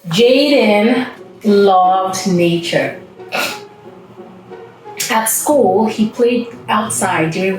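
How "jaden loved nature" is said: usual speaking voice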